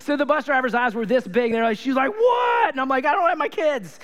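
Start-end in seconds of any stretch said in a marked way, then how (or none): 2.1-2.7 very high yelped question; startled shout. Retold or imitated. Bus driver to running dad, startled shout. 3.1-4.0 high-pitched reenacted panic; frantic pleading. Dad to bus driver about missing kids, frantic.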